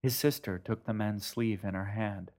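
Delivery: ordinary delivery, nothing marked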